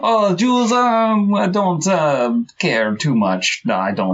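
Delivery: comic Norwegian villain, drawn out; Norwegian accent. Pretend. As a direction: Norwegian accent played for laughs. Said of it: "in a Norwegian accent".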